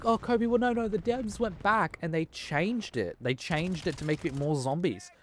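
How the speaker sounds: high, put-on whiny voice of a defender; mocking know-it-all. High smug voice mocking a fan who defends the devs. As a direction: pitch it up and sneer like a smug fanboy. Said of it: "then you're thinking".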